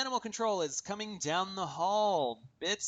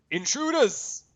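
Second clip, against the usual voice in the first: loud Jersey yell, pitched up; Jersey accent; 10 semitones higher, 7 dB louder, slower than usual. Acted. Guard spotting the party, yelling in a Jersey accent. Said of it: "that was a little more Jersey".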